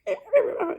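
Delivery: sharp high giggle; high laugh. Real, not acted. Comparing dad's voice to Charlie Brown adults, laughing. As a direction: laugh at the memory.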